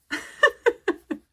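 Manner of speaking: a sudden high, loud burst of laughter; delighted laugh. Real, not acted. Thrilled by the tarot pairing, delighted burst of laughter. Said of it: "Pardon my dramatic reactions".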